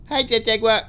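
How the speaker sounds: put-on high, loud dubbed child greeting; comic high-pitched fake child voice; adult faking a kid. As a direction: squeal it like a badly dubbed kid. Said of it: "an adult trying to sound like a kid".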